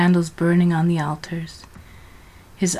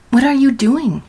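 shocked question; 7 semitones higher, 5 dB louder than usual. A narrator's reading. Georgina shocked by dead dove.

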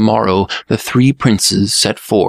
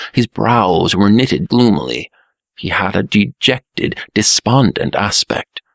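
ordinary delivery, nothing marked

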